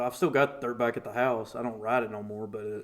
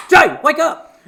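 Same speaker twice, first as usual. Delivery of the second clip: high, loud frantic shout to wake him; panicked shout; 13 semitones higher, 13 dB louder than usual. Retold or imitated. Dummy hitting his head to wake him, frantic shout. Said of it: "He goes".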